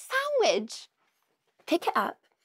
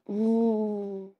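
a contented tasting hum; happy tummy-rubbing mmm; yummy mm. Pretend. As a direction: hum as if the jam tastes delicious. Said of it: "And Bear can go".

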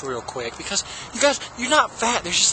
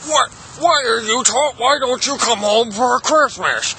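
put-on, drawn-out dad voice, pitched up; imitated dad voice; 5 semitones higher than usual. Dad asking child to come home, odd imitated voice. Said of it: "sound like the guy from Micro Center".

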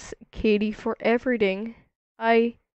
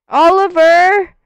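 calling out; 6 semitones higher, 10 dB louder than usual. Pretend. Calling out for a lost Oliver.

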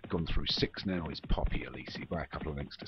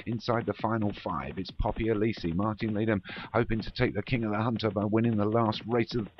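building excitement, pitch climbing steadily; live race commentary; 7 semitones higher, 5 dB louder than usual. Real, not acted. Commentator calling the closing stages, increasingly excited. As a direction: drive the call on as the finish nears.

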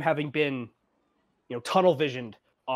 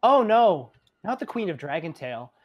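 put-on a little girl correcting a grown-up; Evandra; 3 semitones higher than usual. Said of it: "She goes".

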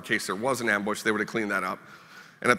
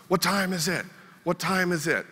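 lightly voiced dazed Marine, a bit higher; a hint of a concussed kid looping the same question; dazed repeating; 8 semitones higher than usual. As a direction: mimic a confused young soldier asking over and over. Brain-injured Marine repeating himself.

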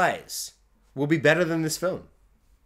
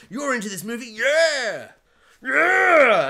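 higher and building louder mock cheer; hammed-up hype; 6 semitones higher, 6 dB louder, slower than usual. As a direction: pump up a fake crowd. Mocking the director's hype.

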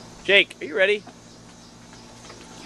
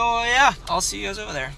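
usual speaking voice

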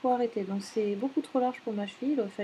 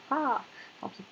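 ordinary delivery

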